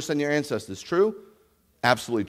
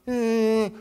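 dismissive shrug; 9 semitones higher, 3 dB louder than usual. Retold or imitated. World shrugging it off.

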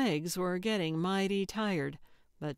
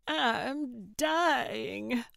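higher, drawn-out agonized wail, acted; suffering; 6 semitones higher, 3 dB louder than usual. A narrator's reading. Diner complains to partner after five-star spice, suffering. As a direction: groan it out in hammed-up agony.

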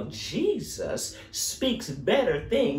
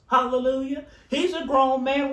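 ordinary talk, nothing marked

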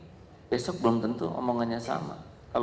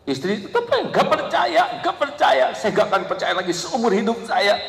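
higher, hammed-up wife refusing to believe; mock sulky wife; 12 semitones higher, 4 dB louder than usual. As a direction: play the stubborn wife for laughs. Imitating a sulking wife.

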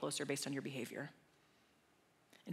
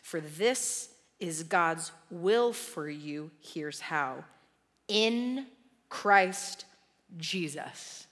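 louder, fervent preaching, slowing on key lines; impassioned preaching; 10 dB louder, slower than usual. Real, not acted. Preacher urges congregation to pray continually, fervent and loud. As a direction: preach it out with full conviction.

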